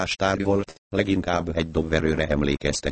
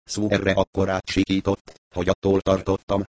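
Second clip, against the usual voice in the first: usual speaking voice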